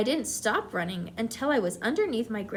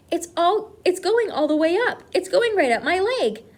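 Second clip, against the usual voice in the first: high, loud panic as it climbs; panicked yelling; 7 semitones higher, 7 dB louder than usual. A narrator's reading. Cook yelling as the mouse runs up his leg, panicked shout. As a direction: yell in rising alarm. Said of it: "the man was yelling".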